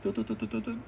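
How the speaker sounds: a soft, high cartoon shrink effect; shrinking sound effect. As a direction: tinkle it out as he shrinks. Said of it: "you can see the sound effect".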